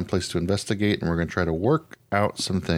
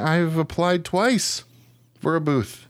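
exasperated complaint; 9 semitones higher, slower than usual. Real, not acted. Complaining about ignored applications.

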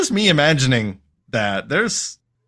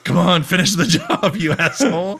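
high, loud goading with a laugh; mock villain taunt breaking into laughter; 6 semitones higher, 5 dB louder than usual. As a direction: goad the rescuer, hammed up. Villain taunts his rescuer, laughing.

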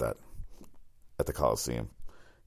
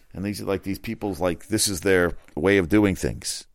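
ordinary talk

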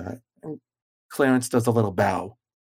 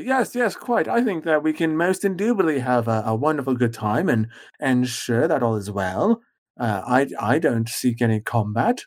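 put-on pompous old mage. GM voices Doombringer's reply.

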